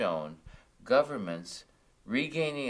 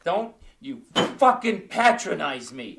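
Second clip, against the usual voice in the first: put-on louder, higher drunken snarl; furious drunk shout; angry outburst; 8 semitones higher, 12 dB louder than usual. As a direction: lash out at the friend. Drunk man snaps at friend.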